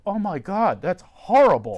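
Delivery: imitating people gasping in horror; shocked reaction. Scene: listeners hearing of his childhood, mock horror.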